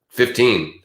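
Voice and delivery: ordinary talk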